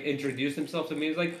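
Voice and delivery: normal talking voice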